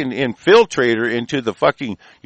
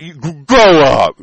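slow, louder mock yell of grow up; 6 dB louder, slower than usual. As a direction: bark it out slowly, imitating a bully. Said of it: "yells it like he's got something".

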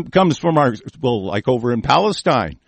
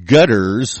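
usual speaking voice

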